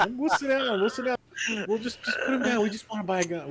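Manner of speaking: high, eager to please; to a gunman holding his friend, nervous and high; nervous placating. Pretend. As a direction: quickly back down in a high voice. Placating armed mafioso.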